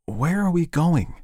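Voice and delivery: high, slow, worried question. Acted. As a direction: ask as a bewildered wife. Frightened wife asking Ed.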